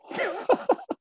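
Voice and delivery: a quick high giggle; short high amused laugh. Real, not acted. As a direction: giggle up high at his own joke. Host laughing after example sentence.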